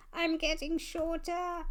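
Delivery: a playful monster shriek; mock monster scream. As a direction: squeal it like a monster getting smaller. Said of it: "The monster screamed".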